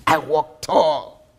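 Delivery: slow, emphatic proud declaration. A narrator's reading. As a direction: say it standing tall, drawn out. Proud recalled boast, emphatic.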